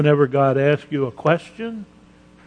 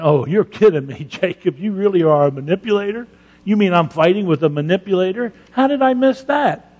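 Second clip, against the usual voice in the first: mock-amazed, fast and higher, with a laugh; mock surprise; 5 semitones higher, 4 dB louder than usual. Preacher voicing God teasingly surprised at Jacob, mock amazement. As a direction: play God feigning shock, teasing Jacob. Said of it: "Like saying".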